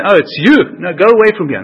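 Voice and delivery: loud, exasperated dismissal; acted angry rejection. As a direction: turn away an unwelcome visitor, loudly.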